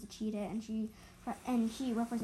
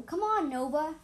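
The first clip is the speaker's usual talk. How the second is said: calling out; 5 semitones higher, 5 dB louder than usual. Acted. Calling down the hill.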